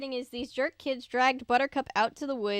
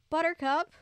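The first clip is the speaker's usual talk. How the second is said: higher questioning call; hopeful call; 6 semitones higher, 4 dB louder than usual. A narrator's reading. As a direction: call the dog, hoping. Hopeful call seeing dog.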